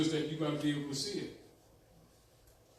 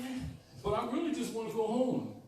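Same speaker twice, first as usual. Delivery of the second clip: put-on high, pleading girl; Dorothy voice; 7 semitones higher than usual. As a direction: whine it like a homesick girl. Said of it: "And Dorothy says".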